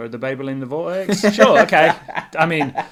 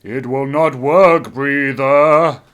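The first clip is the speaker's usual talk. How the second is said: slow, loud haunting ghost; 10 dB louder, much slower than usual. Acted. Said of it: "stereotypical ghost voice".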